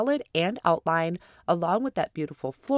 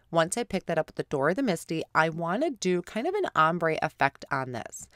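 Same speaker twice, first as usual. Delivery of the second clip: ordinary talk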